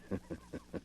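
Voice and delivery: quiet breathy villain's laugh; sinister soft chuckle. Acted. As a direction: chuckle darkly to yourself. Villain laughs after taunting.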